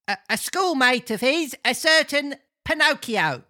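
high-pitched character voice; comic old man. Acted.

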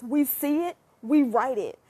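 usual speaking voice

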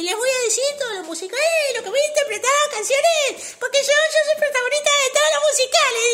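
high, loud, showy stage announcement; Bradford. Acted. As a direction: proclaim it like a self-styled musical star. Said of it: "empezó a cantar y dice".

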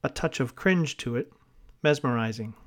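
ordinary talk, nothing marked

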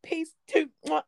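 high, breathy mock sign-off; high mock-girly peace and kiss; mocking the sister. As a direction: parody her kiss goodbye. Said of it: "some girl, like".

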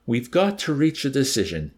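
ordinary delivery

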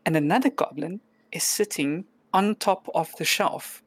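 ordinary talk, nothing marked

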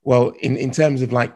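usual speaking voice

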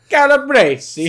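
high, loud, slow hammed-up Italian; over-the-top Italian exclamation; mock Italian accent. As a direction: sing out each syllable in showy Italian. Trading exaggerated Italian food names.

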